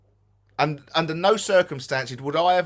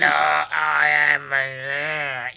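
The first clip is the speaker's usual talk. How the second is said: funny creepy voice; much slower than usual. Shown as a demonstration. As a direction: draw the words out in one creepy, funny drone. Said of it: "make it sound funny".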